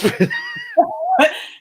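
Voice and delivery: mock laugh. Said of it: "He goes".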